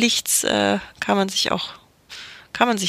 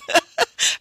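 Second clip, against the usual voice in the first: high laugh. Real, not acted. Laughing about spotting the killer.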